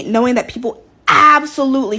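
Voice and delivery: ordinary talk, nothing marked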